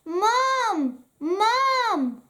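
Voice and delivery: put-on child calling mom. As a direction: call up the stairs for mom. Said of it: "Daisy called".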